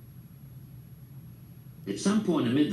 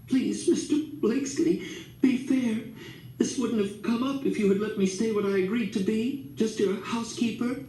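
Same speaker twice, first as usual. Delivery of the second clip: higher, tearful plea; pleading through tears; 10 semitones higher than usual. A narrator's reading. Miss Love begging Grandpa to be fair, tearful and high. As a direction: beg him to be fair, voice near breaking. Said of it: "Miss Love was crying".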